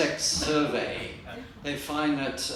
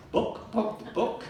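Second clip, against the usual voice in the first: clucky chicken voice saying book; chicken clucking; 8 semitones higher, slower than usual. Acted. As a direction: cluck the words like a hen. Chicken in the library joke.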